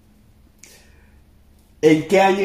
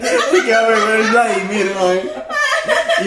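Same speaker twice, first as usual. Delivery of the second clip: teasing laugh, high squeal; laughing through words; 6 semitones higher, much slower than usual. Real, not acted. Laughing retort.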